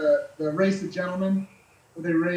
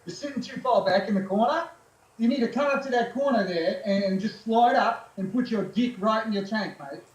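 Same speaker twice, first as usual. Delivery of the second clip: put-on Australian accent; 3 semitones higher than usual. Aussie riding coach telling a new rider where to sit, in an Australian accent. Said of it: "you do a really good Australian accent".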